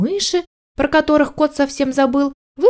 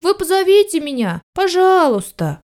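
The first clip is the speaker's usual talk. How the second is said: higher-pitched, tentative plea; timidly brave puppy; 7 semitones higher than usual. A narrator's reading. Puppy begs the dog to call him, timidly brave. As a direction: plead politely, gathering a little courage. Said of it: "щенок набрался храбрости, зажмурился".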